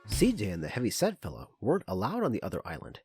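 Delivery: usual speaking voice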